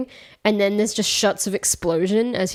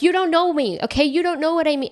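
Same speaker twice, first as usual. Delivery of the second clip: high-pitched play-fight protest; mock lovers' quarrel; 7 semitones higher than usual. Podcaster imagining a brief fight with a partner, mock indignant. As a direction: act out a melodramatic tiff, voice jumping up. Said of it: "a brief instance of us fighting".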